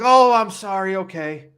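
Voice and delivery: high, loud exaggerated "oh, sorry"; mock apology. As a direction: play up a flustered clerk's apology. Acting out flustered associate apologizing.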